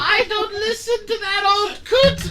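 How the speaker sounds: high, loud doddering granny; a cheeky old crone selling her husband; cackling old lady. Acted. GM holds old woman character.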